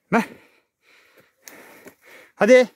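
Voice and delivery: sharp loud high call. Real, not acted. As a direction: shout to the animal. Calling the cow.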